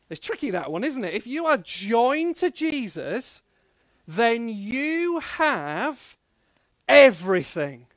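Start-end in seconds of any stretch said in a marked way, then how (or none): 1.1-7.9 slow build, pause, then EVERYTHING loud; call-and-response line. Shown as a demonstration. Preacher leading the children in a chant, slow then loud. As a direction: hold it, pause, punch everything. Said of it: "can you do that with me".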